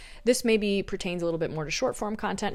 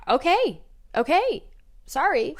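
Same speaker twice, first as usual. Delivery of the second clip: flustered; 9 semitones higher, 8 dB louder than usual. Real, not acted. Catches herself talking fast.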